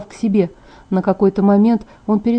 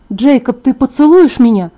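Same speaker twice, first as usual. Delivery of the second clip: higher, louder impulsive question; sudden raised-pitch plea; blurted out; 4 semitones higher, 4 dB louder than usual. A narrator's reading. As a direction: blurt it out without thinking. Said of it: "выпалила, не задумываясь".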